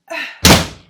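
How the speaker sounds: big loud huff of a sigh; loud exasperated sigh. Real, not acted. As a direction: sigh loudly, fed up. Exasperated at cat.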